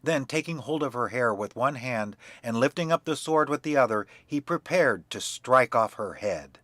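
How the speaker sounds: plain reading voice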